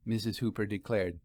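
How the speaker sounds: ordinary delivery, nothing marked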